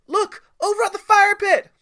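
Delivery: put-on high loud excited kid shout; child yelling to the troop at the fire pit. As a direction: call out, pointing, far across. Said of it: "Timmy yelled".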